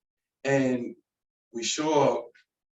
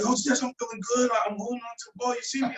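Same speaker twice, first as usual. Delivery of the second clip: higher and fast, giddy; excited boast; 10 semitones higher, faster than usual. Retold or imitated. Bo boasting to his coach, giddy and excited. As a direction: brag like a thrilled kid. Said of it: "And he's like".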